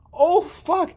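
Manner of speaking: high, loud "oh no" reaction; acted dismay. Brother hearing sister insult his ex-girlfriend, high appalled outburst. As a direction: let it burst out high and appalled.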